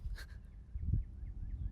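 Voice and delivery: breathy, soft laughing; real light laughter; amused laugh. Real, not acted. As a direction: chuckle breathily at your own words. Laughing at own affirmation.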